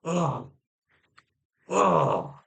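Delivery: drawn-out orgasm-like "oh"; mock strained groan. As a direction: groan it out in imitation, with gaps between. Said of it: "That's what it sounded like".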